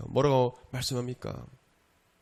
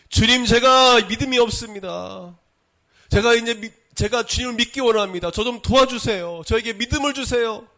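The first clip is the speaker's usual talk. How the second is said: loud, high-pitched pleading; desperate prayer; 11 semitones higher, 7 dB louder than usual. Acted. Preacher modelling a prayer that asks for faith, desperate prayer. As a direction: beg earnestly, voice raised and high.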